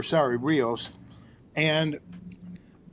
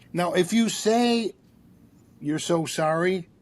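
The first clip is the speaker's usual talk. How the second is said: usual speaking voice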